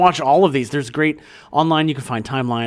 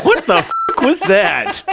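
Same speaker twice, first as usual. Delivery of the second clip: high, slow, startled question; 9 semitones higher, 3 dB louder than usual. Real, not acted. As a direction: react in disbelief. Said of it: "Oh, my God".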